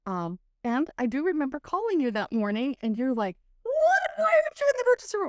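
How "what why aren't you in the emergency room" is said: very high, fast mock outcry; shocked friend imitation. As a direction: imitate a friend aghast on the phone. Imitating friend's alarmed phone reply.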